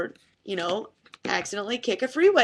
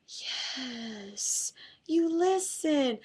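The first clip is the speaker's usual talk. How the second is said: much softer, slow, savouring victory; quiet triumphant yes; 3 semitones higher, 8 dB quieter, much slower than usual. Retold or imitated. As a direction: murmur it to yourself, drawn out, gloating softly. Quiet triumph as student obeys.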